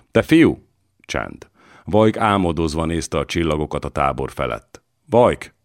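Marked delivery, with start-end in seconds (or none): 0.1-0.7 sharp call, louder and higher. Acted. Father calls his dreaming son, loud sharp call. 5.1-5.5 a loud call, higher. Acted. Father calls Vajk out of his reverie, loud call.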